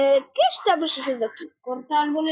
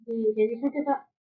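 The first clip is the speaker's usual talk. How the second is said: ordinary talk, nothing marked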